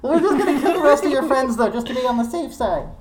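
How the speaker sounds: high-pitched goblin calling out; squeaky goblin shout, casually threatening; goblins yelling out. Acted. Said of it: "a couple of the goblins yell out".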